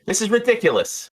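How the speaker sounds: high, fussy complaining voice; fussy bartender. Acted. Jeannie complaining to Dukes about the bottle breakers, high and fussy. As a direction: grumble about the rowdy fraggers.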